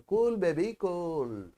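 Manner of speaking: slow, soft self-soothing "cool baby cool"; drawn out gently, as if calming yourself; calming mantra. Shown as a demonstration. Teacher showing the listeners the mantra, slow and soft. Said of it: "ఒక మంత్రం practice చేయాలి".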